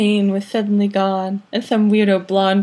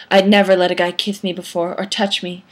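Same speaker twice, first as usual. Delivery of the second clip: usual speaking voice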